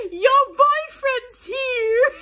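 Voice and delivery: high, loud, drawn-out squealing yell; screamed reply. Retold or imitated. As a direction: re-enact yelling across a car park. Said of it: "And I screamed".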